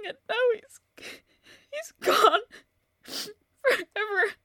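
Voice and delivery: ordinary talk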